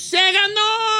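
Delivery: high, stretched victory shout; triumphant announcement. Real, not acted. Host celebrating Giovanna's winnings, triumphant shout. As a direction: hold out the win at full pitch.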